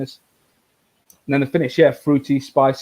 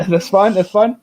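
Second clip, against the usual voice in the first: laughing; 6 semitones higher, 5 dB louder than usual. Real, not acted. Laughing at cohost's abstaining.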